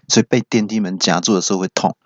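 ordinary talk, nothing marked

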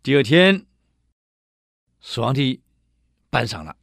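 ordinary delivery

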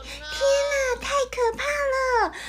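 shocked cry of alarm; horrified. Acted. Shock at fox biting turtle.

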